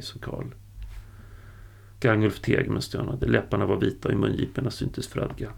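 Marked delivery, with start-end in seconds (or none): none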